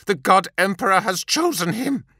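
high, intense zealot voice; fervent vow. A narrator's reading. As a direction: swear it with fervour. Serf's fervent inner vow.

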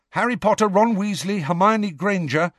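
high, hurried list of names; very quickly. A narrator's reading. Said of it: "Harry said very quickly".

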